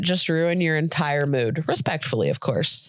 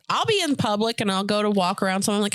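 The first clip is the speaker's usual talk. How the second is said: usual speaking voice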